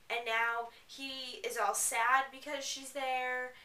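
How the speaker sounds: ordinary talk, nothing marked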